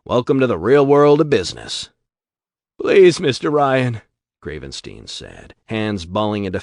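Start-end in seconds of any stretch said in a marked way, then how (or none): 0.0-1.9 sneer. Acted. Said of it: "Shep sneered". 2.8-4.0 begging. Acted. Gravenstein begs Ryan.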